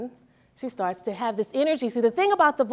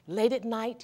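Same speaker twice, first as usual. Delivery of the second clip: ordinary talk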